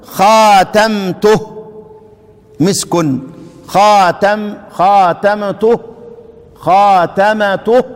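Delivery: خاتمته drawn out with rising end; quoting the example slowly; slow demonstration. Shown as a demonstration. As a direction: stretch خاتمته. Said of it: "كما تقول".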